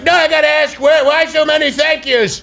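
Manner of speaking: high, insistent Trump; pressing Trump asking again; Trump impression. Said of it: "he's like".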